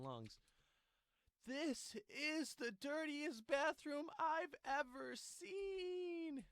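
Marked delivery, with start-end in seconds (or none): 1.5-6.4 high, slow, loud screeching wail; screaming impression. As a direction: shriek it out like a woman losing it on the floor. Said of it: "that was just my impression of it".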